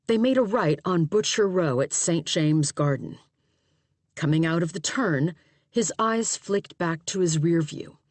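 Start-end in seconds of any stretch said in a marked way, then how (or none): none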